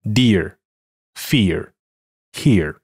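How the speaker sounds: American "dear, fear, here" with an r-colored ending; American English. Shown as a demonstration. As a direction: curl the tongue for the final r, American style. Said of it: "美式英语".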